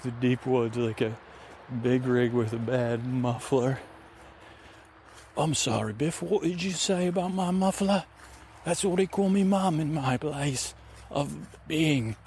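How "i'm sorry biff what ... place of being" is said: lightly voiced puzzled adventurer; Kiwi Shavers character. Adventurer questioning the ranger's remark, lightly put-on voice. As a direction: rise into an oddball character voice.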